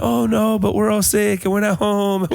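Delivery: high, drawn-out mock lament; imitating his wife's whine. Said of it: "She's like".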